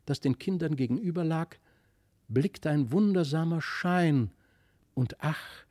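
plain reading voice

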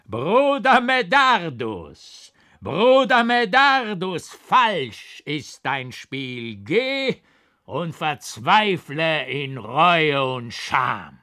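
high, loud, slow scornful voice; a spectral painter scorning Brother Medardus; mocking ghostly painter. A narrator's reading. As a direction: taunt the monk with eerie derision. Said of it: "lachte im fürchterlichen Hohn".

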